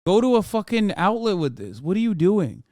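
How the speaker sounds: ordinary delivery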